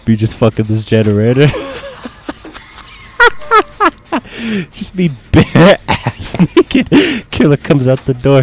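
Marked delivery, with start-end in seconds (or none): 1.5-6.9 laughing. Real, not acted. Laughing at own joke.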